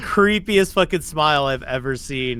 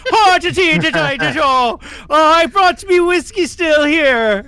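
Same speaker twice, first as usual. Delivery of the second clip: loud, high mock-Irish villager; over-the-top stage Irish, pitched way up; hammed-up Irish; 12 semitones higher, 6 dB louder than usual. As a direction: wail it in a cartoon Irish accent. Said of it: "I don't know what accent I'm doing".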